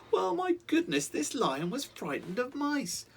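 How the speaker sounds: amazed narrator. A narrator's reading. Narrator marvels at scared lion.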